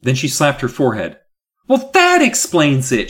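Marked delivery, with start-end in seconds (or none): 1.7-3.1 high, drawn-out exclamation; slow, loud cry of realization. A narrator's reading. Miss Carmen slapping her forehead, crying out. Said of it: "she cried".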